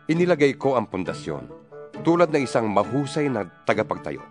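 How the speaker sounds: ordinary delivery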